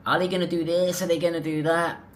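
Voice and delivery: high, loud mock American girl voice; mocking impression of a loud American girl; annoying American girl.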